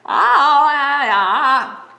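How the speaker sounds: loud high cries of ah; yelling. Acted. Bear under the bugs, loud high yells. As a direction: yell from under the bug pile. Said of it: "something was yelling".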